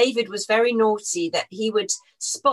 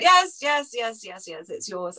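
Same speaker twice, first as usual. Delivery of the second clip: impatient boss; 3 semitones higher, slower than usual. Retold or imitated. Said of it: "And he went".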